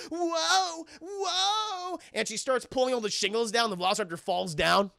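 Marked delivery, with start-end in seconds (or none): 0.1-2.0 high drawn-out mock whoa; slipping raptor. Raptor sliding on the shingles, high drawn-out whoa. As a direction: wobble it like a raptor sliding on marbles. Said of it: "like, marbles going".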